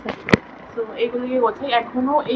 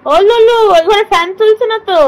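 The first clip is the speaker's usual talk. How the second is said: very high, loud pet call; high-pitched, raised coaxing voice; 9 semitones higher, 5 dB louder than usual. Real, not acted. Calling the pet from another room.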